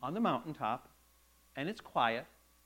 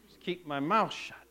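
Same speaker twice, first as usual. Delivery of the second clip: relieved aside; 6 semitones lower than usual. Retold or imitated. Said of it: "standing back going".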